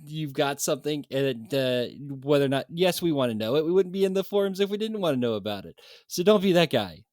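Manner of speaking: loud exasperated scolding; hammed-up forum rant; mock rant. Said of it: "Of course, I was kidding".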